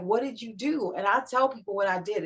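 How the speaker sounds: normal talking voice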